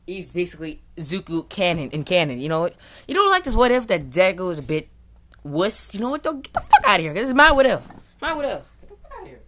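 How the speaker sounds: loud, high yelling at the critics; furious shout. Real, not acted. Streamer yelling at chat who call Deku a wuss, furious shout. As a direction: blow up at chat, it's my what if.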